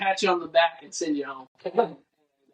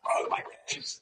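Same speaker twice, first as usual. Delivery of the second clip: high, weak, voiceless gasp; winded groaning; 9 dB quieter, slower than usual. Retold or imitated. Injured father after the fall, strained winded gasp. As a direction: imitate a hurt man squeezing out words, softly.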